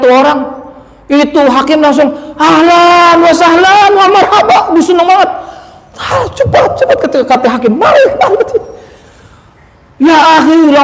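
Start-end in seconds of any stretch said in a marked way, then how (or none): 2.3-4.6 joyful welcome. Acted. Judge greets debtor joyfully. 5.9-7.0 eager hurry. Acted. Judge eagerly hurries the man. 7.8-8.6 high, eager beckoning; eager welcome. Acted. Judge beckoning the debtor in, high and delighted.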